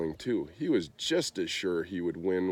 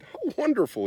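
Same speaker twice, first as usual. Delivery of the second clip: very high and a touch louder; delighted cry; 4 dB louder than usual. A narrator's reading. King cheering the giant's piano, high excited cry. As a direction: burst out in royal glee. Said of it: "he cried".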